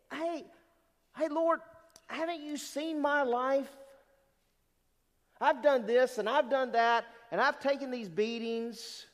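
high-pitched plea of grievance; protesting. Acted. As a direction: complain to God, voice pushed up. Acting out complaint to the Lord.

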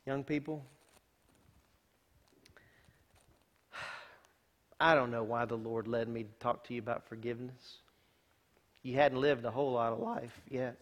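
soft, low, sincere; quiet heartfelt word to the youth; tender appeal. Real, not acted. Pastor turns to youth softly.

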